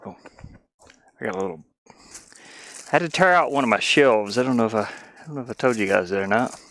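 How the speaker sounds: normal talking voice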